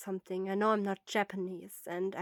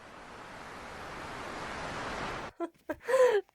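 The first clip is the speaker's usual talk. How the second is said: nervous laugh; 13 semitones higher than usual. Real, not acted. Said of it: "my little voice acting".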